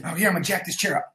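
ordinary delivery

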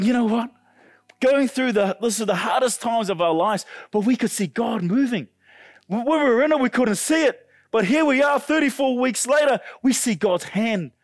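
high, loud preaching fervour; retold testimony at full preaching pitch; impassioned testimony. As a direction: testify with mounting conviction. Acts out parents' impassioned testimony.

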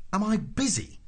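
high-pitched, indignant echo of a question; incredulous exclamation. A narrator's reading. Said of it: "exclaimed Arthur".

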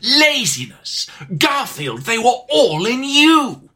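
slow, grandiose proclamation; mock-epic game villain reveal; hammed-up villain monologue. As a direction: declaim it, stretching and swelling key words. Hammed-up villain monologue about Garfield.

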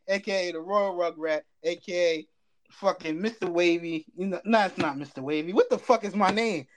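ordinary delivery

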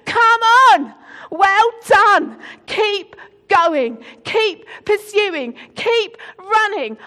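loud, high rallying cry; shouting over you. Retold or imitated. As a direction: roar encouragement at a runner. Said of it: "God wants to shout over you".

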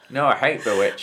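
ordinary talk, nothing marked

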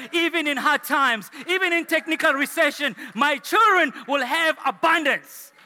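high, loud, rousing sermon delivery; full-force preaching. Real, not acted. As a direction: proclaim the promise to the congregation.

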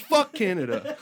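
loud, high parting curse; mock defiant outburst. Banned musician cursing the country, mock-defiant outburst. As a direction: throw it out as a comic kiss-off.

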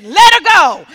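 high shouted order; forceful command. Retold or imitated. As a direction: shout it to the helpers. Healer orders women to release.

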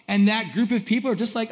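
usual speaking voice

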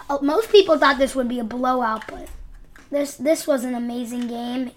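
normal talking voice

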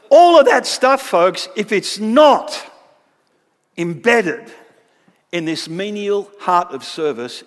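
loud preaching emphasis; emphatic preaching. Real, not acted. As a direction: hammer it home to the room. Preacher driving home point.

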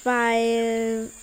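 halting, fading stammer; stuck on the word, trailing off. A narrator's reading. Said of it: "stotterte Pupsi".

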